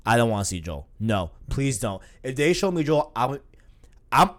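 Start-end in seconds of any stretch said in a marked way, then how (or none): none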